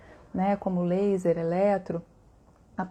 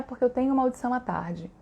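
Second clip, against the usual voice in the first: usual speaking voice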